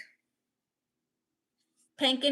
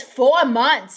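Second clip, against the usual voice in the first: emphatic; 11 dB louder than usual. Acted. Reading chat's outraged point aloud.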